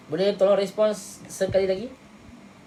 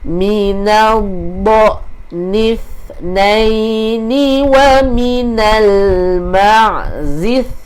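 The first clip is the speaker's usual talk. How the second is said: drawn-out tajwid recitation, higher; slow Quran recitation; 4 semitones higher, 11 dB louder, much slower than usual. Shown as a demonstration. Teacher repeating the verse for students to follow. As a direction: recite slowly, stretching each syllable.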